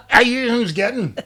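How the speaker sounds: a Belfast waitress asking the visitors again, slow and blunt. Retold or imitated. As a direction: drag it out, blunt and bored. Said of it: "the waitress is like".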